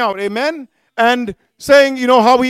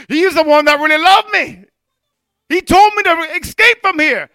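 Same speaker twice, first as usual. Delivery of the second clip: higher-pitched cry of belated realization; raised, pleading preacher's re-enactment; imagined anguished cry; 5 semitones higher, 4 dB louder than usual. Retold or imitated. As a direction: cry out as a sinner realizing too late. Voicing regretful soul in hell.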